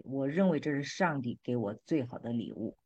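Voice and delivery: ordinary talk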